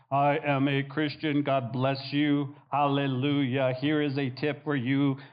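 low robotic monotone. Acted. Preacher mimics believers forced to witness, robotic monotone. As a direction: recite it like a robot, evenly measured. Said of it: "wanting us to be a robot".

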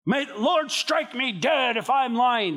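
high, slow, ringing curse; acted oath-swearing. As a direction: call down a curse on yourself, hammed-up. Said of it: "the curse was something like".

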